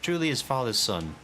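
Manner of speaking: high, hearty folksy adventurer; jovial rogue. Acted. Morgant vouching for Hildebrand to Guguremu, hearty. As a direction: vouch for the lad with a grin.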